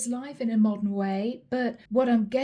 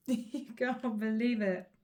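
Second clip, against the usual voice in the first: laughing; 4 dB quieter than usual. Real, not acted. Laughing at the cheating partner's logic.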